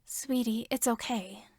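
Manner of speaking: soft, kindly reassurance; kind mother. A narrator's reading. Missus Midoriya comforts the crying boy, softly kind. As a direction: comfort him gently like a mother. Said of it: "she said kindly".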